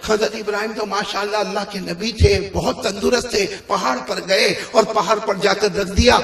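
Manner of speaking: soft preacher's storytelling; hushed story narration. A narrator's reading. Preacher recounts Allah's orders to Ibrahim, hushed narration. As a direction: draw the congregation in softly.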